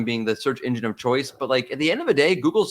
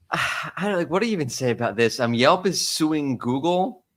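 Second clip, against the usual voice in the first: ordinary talk, nothing marked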